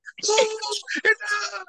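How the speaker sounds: high, drawn-out mock office chirp; mock work-talk noise. Husband teasing how his wife talks at work, high mock chirp. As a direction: imitate their other language in a high squeal. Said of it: "they got this other language".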